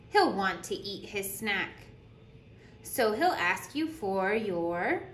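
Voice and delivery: lively storytime voice, bouncing pitch; animated picture-book reading; reading to children. Acted. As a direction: read it like a teacher at story circle. Reads picture book aloud.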